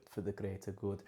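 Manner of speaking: a lightly done Hot Fuzz villager chant with a mock wrong accent; Hot Fuzz quote. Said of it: "Hot fuzz, hot fuzz quote there".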